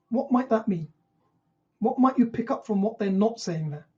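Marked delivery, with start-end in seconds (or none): none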